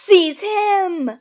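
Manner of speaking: high furious scream; villain screaming an order at his servant. A narrator's reading. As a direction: shriek the order. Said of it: "but Voldemort screamed".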